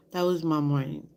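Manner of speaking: ordinary delivery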